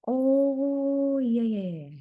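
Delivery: long, high, loud "oh" sliding down; drawn-out oh of dawning understanding; sudden realization. Real, not acted. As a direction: let it click, loudly. Sudden realization while working through grammar.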